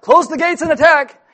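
high, loud mock command; shouted mock attack order; mock battle cry. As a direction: call it out like an army order.

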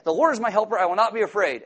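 loud and high, proclaiming it; bold declaration. Shown as a demonstration. Preacher urging the anxious to proclaim it. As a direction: declare it with force like a shield. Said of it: "just be like that".

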